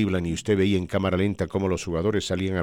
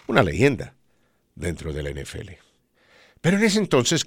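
normal talking voice

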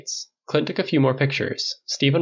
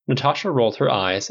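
usual speaking voice

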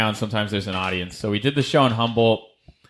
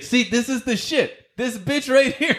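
high put-on voice of the ranting guy; imitating a stranger's rant, pitched way up; mock ranting stranger; 13 semitones higher than usual. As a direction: whine it out like the unhinged ranter.

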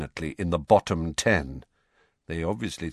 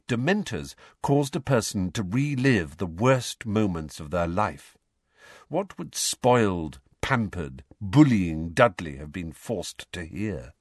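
usual speaking voice

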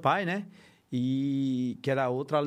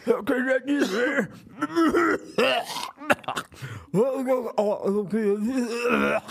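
put-on very old man; 4 semitones higher, slower than usual. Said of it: "faz o velho Nunga aí".

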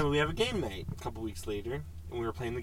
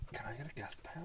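whispered quote; 17 dB quieter than usual. Retold or imitated. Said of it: "she's like".

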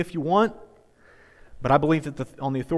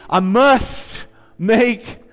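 loud, raised and drawn out; rallying declaration; 5 semitones higher, 7 dB louder, slower than usual. Shown as a demonstration. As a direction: proclaim it slowly for the congregation. Leading congregation in a recitation.

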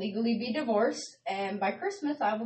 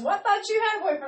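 mom's voice; 10 semitones higher, 4 dB louder, faster than usual. Retold or imitated. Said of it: "And she goes".

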